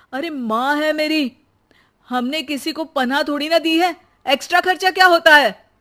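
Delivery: rising angry shout, fast at the end; furious husband defending his mother; angry retort. Acted. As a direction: blast her for calling mother a cost. Husband angered by wife.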